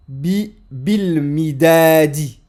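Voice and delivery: bil midadi, very slow, every syllable clear; deliberate model of bil midadi; slow model reading. Shown as a demonstration. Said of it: "Donc là on décortique".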